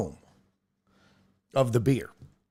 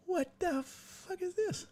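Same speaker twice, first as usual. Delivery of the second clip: incredulous squeak; 12 dB quieter, slower than usual. Real, not acted. Reacting to broken graphics.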